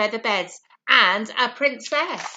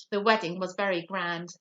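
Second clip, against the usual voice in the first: ordinary delivery, nothing marked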